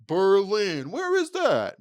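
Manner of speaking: high, slow, clueless voice; dim-witted mock voice. Mocking a clueless viewer who asks where Berlin is. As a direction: play dumb about where Berlin is. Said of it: "maybe some people are like".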